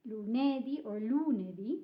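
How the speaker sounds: LUNEDÌ first stressed on NE, then on LU; wrong stresses. Shown as a demonstration. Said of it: "you have to stress the word there".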